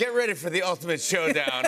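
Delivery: booming trailer announcer; movie trailer voice. Pretend. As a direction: sell it like a blockbuster trailer. Host mock movie trailer intro.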